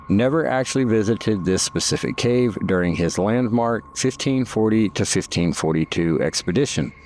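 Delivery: measured, informative guided-tour read; documentary narration. Acted. Documentary narration over cave footage.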